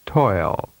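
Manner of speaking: "Toil." held long and falling; drawn-out "Toil."; long vowel. Shown as a demonstration. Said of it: "and then with a longer duration".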